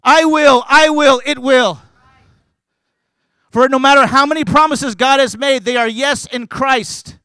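loud, impassioned sermon delivery; full-force preaching. Real, not acted. Preacher urging the congregation that God wants to use them, full-force preaching. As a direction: preach it out, punching the key words.

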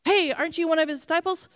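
lightly voiced bystander; pressing accuser. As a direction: catch him out. Another accuser presses Peter.

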